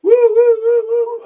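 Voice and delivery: high, loud whooping; shouted party woos; hype whoops. Real, not acted. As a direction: whoop it up for the chat. Hyping up the live chat.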